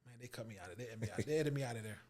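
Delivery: low, soft muttered worry; resigned worry. Retold or imitated. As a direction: grumble it softly to yourself, expecting the worst. Retold private worry.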